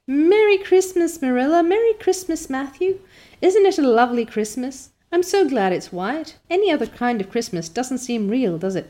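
high, loud and bright with delight; joyful Christmas greeting. Acted. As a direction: call out merry Christmas to the whole house. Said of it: "singing until her voice re-echoed".

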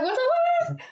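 high-pitched mock scream; retold scream. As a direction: squeal it in mock panic.

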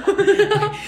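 very high laughing okay; high squeaky okay. Retold or imitated. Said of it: "I'm like".

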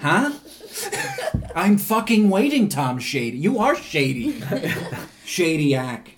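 loud hammed-up rant at the director; fake-furious demand for a dialect coach; mock rant. Mock rant at director over accent.

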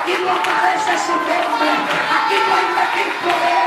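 loud shouted preaching with rising pitch; full-force preaching. Real, not acted. Preacher shouting the commander's words to the church, full force. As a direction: preach it hard, shouting to the congregation.

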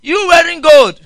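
hammed-up preacher condemning gold; scolding bus preacher. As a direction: accuse her like a fiery preacher. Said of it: "somebody came to preach".

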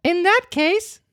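high puppet voice, proud. Acted. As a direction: say it with defiant pride. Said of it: "said Pinocchio proudly".

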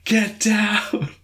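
high, drawn-out mock shout; mock professor yell. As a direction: yell it like a professor, half in jest. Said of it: "yelling as a professor".

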